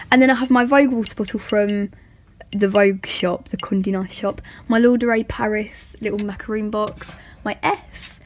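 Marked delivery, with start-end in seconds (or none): none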